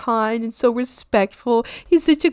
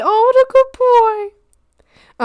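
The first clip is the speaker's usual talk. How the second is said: cooing praise; 11 semitones higher, 4 dB louder, much slower than usual. Real, not acted. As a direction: coo like praising a puppy. Praising chat member's dog.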